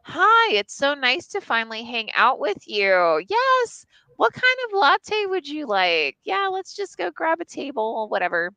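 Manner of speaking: high, sociable put-on politeness; outside voice. Woman greeting her coffee date, putting on an outside voice. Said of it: "My out-, my outside voice is like".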